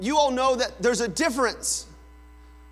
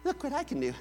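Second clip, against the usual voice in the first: put-on small, high, soft child voice; little kid showing off; 6 semitones higher, 8 dB quieter, much faster than usual. Child to exasperated mother, small and high. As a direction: play a kid proudly wanting to be watched.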